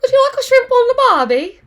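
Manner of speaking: high, hammed-up Australian accent; mock Australian. Teacher doing a jokey Australian accent for the class. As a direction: toss off the Aussie cliché in a jokey Australian accent.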